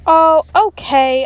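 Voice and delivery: loud, slow, high giving in; giving-in shout. Acted. Boy to teacher, loudly giving up his resistance. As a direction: finally cave and shout it. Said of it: "I finally shouted".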